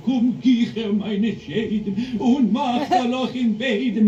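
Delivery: very slow, high, eerie voice in German; trembling monster voice. Acted. Panta Kozak calling his gremlin helpers, slow, eerie and trembling. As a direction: draw every German word out like a spooky ogre. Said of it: "his voice a trembling".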